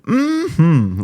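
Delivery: put-on high, loud old-timey "Mm-hmm"; 1930s movie character.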